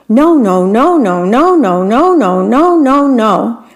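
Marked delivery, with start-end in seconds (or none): none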